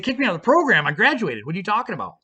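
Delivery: high, loud disbelieving echo of their threat; incredulous. Retold or imitated. To the program office, shocked they'd expel him after graduating, incredulous. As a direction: throw their own words back in disbelief. Indignant reply to being kicked out.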